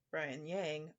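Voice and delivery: ordinary delivery, nothing marked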